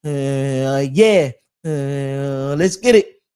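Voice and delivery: building to a loud hype shout; hype karaoke intro. Shown as a demonstration. Showing how he starts a karaoke rhyme, hyped and loud. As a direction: kick off the mic like a rapper. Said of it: "So I'm gonna say".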